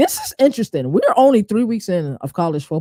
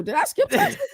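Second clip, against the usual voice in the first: much higher, squeaky mock shock; mock surprise; faster than usual. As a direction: play up the oops moment. Mock surprise at own slip.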